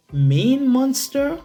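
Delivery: plain reading voice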